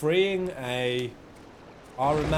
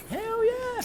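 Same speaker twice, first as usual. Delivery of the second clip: high, whooping cheer; excited cheer. Real, not acted. Streamer cheering that Japan is awesome.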